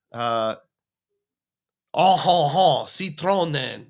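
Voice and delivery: slow, hammed-up French flourish; mock French accent. Host testing whether the car name sounds French, mock French accent. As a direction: chuckle it out in stagy French. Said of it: "That does sound French".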